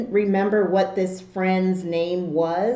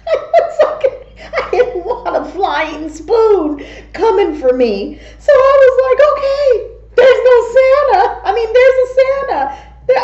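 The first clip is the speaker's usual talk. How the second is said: much higher and louder, breaking into laughter; laughing retelling; 13 semitones higher, 7 dB louder than usual. Real, not acted. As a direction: giggle through the frightened promise to believe. Laughing while retelling scared promise.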